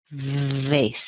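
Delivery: "Vase" with a buzzing drawn-out v, rhyming with face; stretched, voiced opening on "Vase"; voiced V demo. Shown as a demonstration. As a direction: hold the vibrating v, then finish "Vase". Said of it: "add a little voice and vibration".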